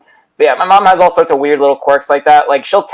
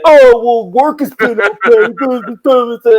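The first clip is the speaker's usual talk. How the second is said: high, slow dopey drone; exaggerated, drawn-out idiot voice, pitched up; mocking moron voice; 8 semitones higher, much slower than usual. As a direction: imitate mom making you sound like a moron. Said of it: "I've seen the way you talk about him".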